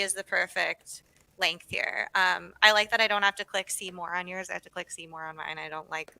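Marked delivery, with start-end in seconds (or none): 4.5-6.1 softer and faster, muttered to herself; quiet quick aside. Real, not acted. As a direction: drop it quickly under your breath. Contrasting her profile with Gwen's.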